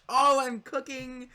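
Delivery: high, slow, drawn-out squeal of delight; excited squeal. Real, not acted. Builder thrilled by his own idea, high excited squeal. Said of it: "I'm getting a little excited".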